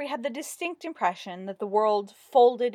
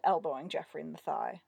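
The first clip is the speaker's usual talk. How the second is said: ordinary delivery, nothing marked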